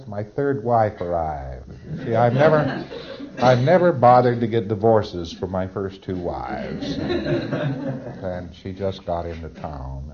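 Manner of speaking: slow confession through laughter; sheepish laughing confession. Retold or imitated. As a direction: laugh it out like an embarrassed client.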